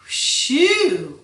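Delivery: loud, high whistled "whew". A narrator's reading. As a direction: let out a big swooping whew. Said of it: "whistled Morris".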